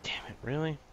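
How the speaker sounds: frustrated whisper; whispered curse. Real, not acted. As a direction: hiss it under your breath. Frustrated under his breath.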